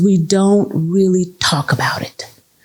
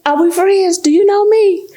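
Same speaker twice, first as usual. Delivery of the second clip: higher hammed-up indignant church lady; sassy insistence; 12 semitones higher than usual. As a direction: demand like an old friend.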